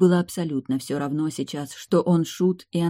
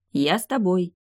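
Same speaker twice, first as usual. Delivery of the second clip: usual speaking voice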